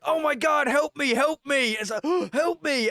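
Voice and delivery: high, slow mock plea for help; imitating a panicked plea. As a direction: wail it like a victim. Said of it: "this dude was like".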